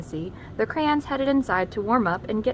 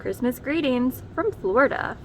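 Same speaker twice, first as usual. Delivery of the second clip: usual speaking voice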